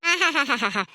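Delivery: low string of ha-ha-ha; mock laugh. Woman told the baby won't come to her, laughing. Scoffing at being refused by baby.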